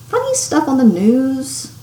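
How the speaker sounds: ordinary delivery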